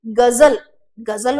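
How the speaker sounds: गजल with a buzzing z, gazal, drawn out first; slow गजल with z, not j; dotted z. Shown as a demonstration. Teacher showing the dotted result, गजल with buzzed z. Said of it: "नुक्ता लगा दिए".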